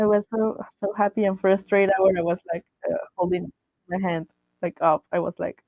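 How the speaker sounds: ordinary talk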